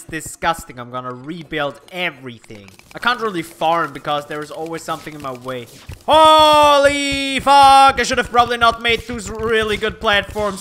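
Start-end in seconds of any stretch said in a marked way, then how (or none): 6.1-8.0 loud, high, drawn-out curse; startled shout. Real, not acted. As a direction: yell it out long and loud. Startled by enemies in game.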